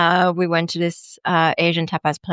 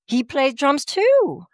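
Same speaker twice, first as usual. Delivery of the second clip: mock copycat; 8 semitones higher, slower than usual. Mocking copycat date.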